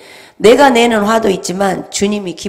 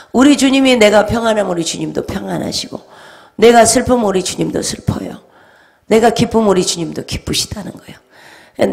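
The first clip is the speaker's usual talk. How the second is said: ordinary talk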